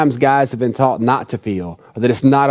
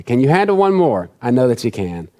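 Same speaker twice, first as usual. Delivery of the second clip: ordinary delivery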